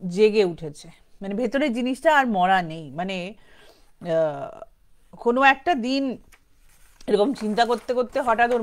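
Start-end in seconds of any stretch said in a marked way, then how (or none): none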